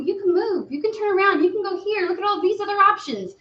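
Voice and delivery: lightly voiced spirit pleading high; coaxing spirit. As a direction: coax them to move on. Spirit urging the stuck client.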